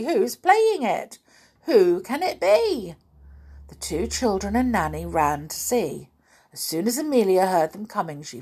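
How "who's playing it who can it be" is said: put-on little boy's cry. Little boy wonders who plays his drum, crying out. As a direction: ask eagerly in a high child's voice. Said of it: "cried the little boy".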